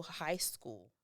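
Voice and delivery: normal talking voice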